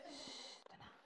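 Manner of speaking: whispered calm-down. Acted. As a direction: whisper "tenang" almost without voice. Whispering during the bomb search.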